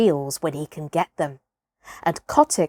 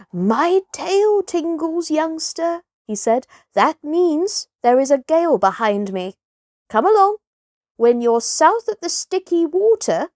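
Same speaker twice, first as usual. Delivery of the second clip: wise porpoise voice; 7 semitones higher than usual. Acted. Porpoise leader guiding young Kotick, high and unhurried.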